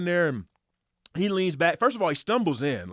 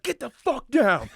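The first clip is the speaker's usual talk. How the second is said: urgent warning; 9 semitones higher, 3 dB louder, slower than usual. Retold or imitated. Spots rifle aimed at them.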